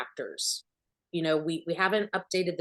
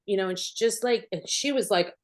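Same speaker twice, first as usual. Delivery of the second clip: ordinary delivery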